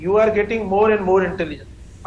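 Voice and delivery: slow, word by word; slow correct example. Shown as a demonstration. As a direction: dictate the correct sentence slowly. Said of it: "अब ये सही है". (सही वाक्य धीरे-धीरे बोलना)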